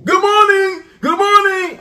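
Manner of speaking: very high, loud holler; hollered greeting. Pretend. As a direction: belt out a mock wake-up call. Bellowing a greeting to the hosts.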